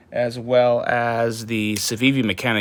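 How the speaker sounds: ordinary talk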